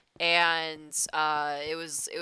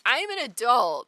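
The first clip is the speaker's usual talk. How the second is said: higher, louder self-quote; indignant protest; 7 semitones higher, 7 dB louder than usual. Retold or imitated. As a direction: protest it like an insulted grown-up. Quoting own indignant protest to coworkers.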